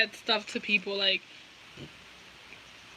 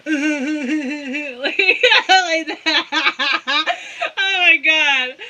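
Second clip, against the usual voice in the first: loud, higher, helpless laughing through the words; cracking up; 4 semitones higher, 7 dB louder, much slower than usual. Real, not acted. Imitating a weird laugh, then really losing it. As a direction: silly laugh tipping into real laughter.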